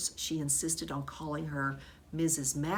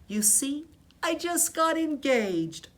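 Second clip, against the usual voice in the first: excited; 9 semitones higher, 7 dB louder than usual. A narrator's reading. Aldo shares happy news.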